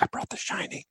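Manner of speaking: hushed secretive whisper. Retold or imitated. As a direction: whisper it like contraband. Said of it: "somebody would always be like".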